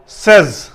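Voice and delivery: isolated सेज rhyming with "fez"; correct pronunciation. Shown as a demonstration. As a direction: say सेज with short "e", as "sez". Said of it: "है बल्कि सेज".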